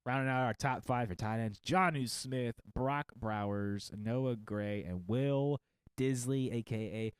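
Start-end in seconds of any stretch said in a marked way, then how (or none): none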